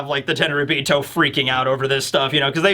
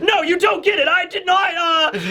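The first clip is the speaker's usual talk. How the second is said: frantic laughing plea; 12 semitones higher than usual. Pretend. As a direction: wave the knife and protest. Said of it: "And you're".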